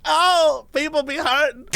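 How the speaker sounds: sing-song mock of her preaching; hammed-up reality-star sass; mock diva. Mocking the girl's speech.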